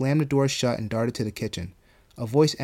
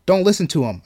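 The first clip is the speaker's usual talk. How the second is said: loud, raised bellow; 6 semitones higher, 7 dB louder than usual. A narrator's reading. New voice warning Marigold, bellowing. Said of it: "another voice bellowed".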